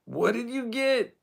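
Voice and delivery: high, slow, mocking squeal; hammed-up jeering at a friend's fancy drink order; obnoxious mocking. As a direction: needle your buddy in a silly high voice. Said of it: "just an asshole about things".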